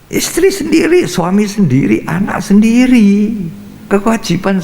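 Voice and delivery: preacher at full force; emphatic preaching cry. Acted. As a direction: call it out, rising each time. Emphatic preaching, loud and high.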